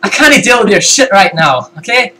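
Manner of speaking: put-on Scottish voice, lifted in pitch; Scottish accent. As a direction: tell them off in a Scottish accent. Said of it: "Was that a good voice?".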